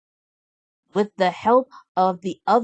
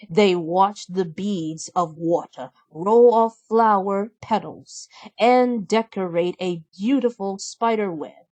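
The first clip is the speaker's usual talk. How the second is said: plain reading voice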